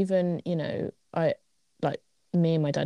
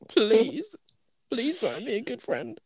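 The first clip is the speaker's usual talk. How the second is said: desperate pleading; 6 semitones higher, slower than usual. Pretend. Begging quote in desert.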